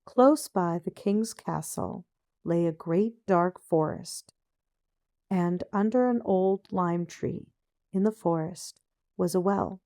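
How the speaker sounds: usual speaking voice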